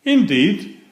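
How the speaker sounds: ordinary delivery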